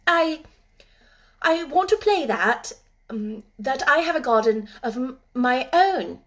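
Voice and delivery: high, hesitant stammer. Acted. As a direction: stammer out nervously. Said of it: "she stammered".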